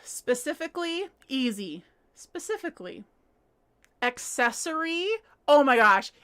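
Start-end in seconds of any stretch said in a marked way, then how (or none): none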